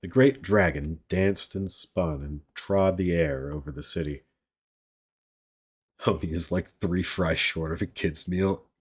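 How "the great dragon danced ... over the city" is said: ordinary delivery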